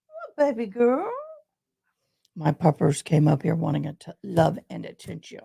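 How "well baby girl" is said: higher sing-song puppy voice; higher cooing pet voice; higher dog talk. Real, not acted. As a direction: lift it up for the dog. Talking to her dog.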